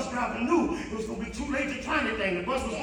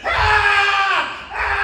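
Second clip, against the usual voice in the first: high, loud imitated scream; anguished scream; 10 semitones higher, 13 dB louder than usual. Driver after hitting his son, imitated loud screams. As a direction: scream out in horror. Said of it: "the bus driver kept screaming".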